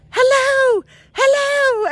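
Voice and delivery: put-on very high, loud kid's holler; little kid's call. Distant kid to lost woman, little kid's call. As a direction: call out high, imitating a child. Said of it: "the kid's going".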